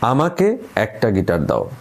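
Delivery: আমাকে punched, said slowly; stress on আমাকে. Shown as a demonstration. Teacher showing stress on আমাকে to music students. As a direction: lean hard on আমাকে. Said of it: "প্রথমটায় জোর দিই".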